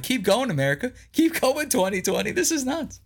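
high, amused alien spectators egging America on; mock cheering aliens. Aliens watching America cheer on the chaos, mocking and amused. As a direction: cheer it on like an alien eating popcorn. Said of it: "They're like".

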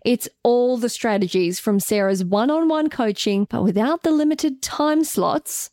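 upbeat slow promo voice; ad read. Acted. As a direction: sell it like a radio ad. Ad read for their course.

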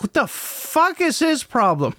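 put-on 2 high, incredulous teen blurt; 3 mimic the daughter's appalled outburst; 1 daughter's shocked reaction. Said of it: "head snapped".

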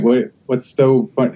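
ordinary delivery

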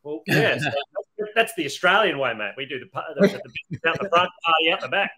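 put-on Australian accent. [name] tells Randy the Australian way in a mock accent. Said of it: "I put an Australian accent".

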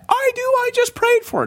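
very high, eager voice of a mom; high-pitched mock excitement; excited mom. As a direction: imitate a mom astonished by answered prayer. Mom's amazed answer to prayer.

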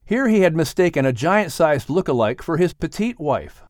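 ordinary delivery

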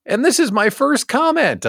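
mock viewer comment. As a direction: play an eager first-time commenter. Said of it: "Drop into the chat and say".

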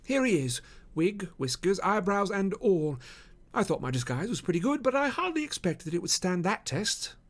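high, light voice for Holmes; amused detective. Acted. Holmes showing Watson his wig and whiskers, amused.

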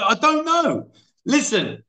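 high-pitched, exasperated denial; pleading ignorance in a high voice; exasperated protest. Real, not acted. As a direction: protest helplessly, pitch shooting up. Exasperated at being questioned about frog.

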